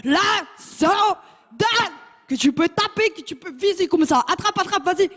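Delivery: high, slow, teasing call; taunting sandal thrower. Acted. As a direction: goad someone to catch it. (lancer moqueur, aigu et traînant)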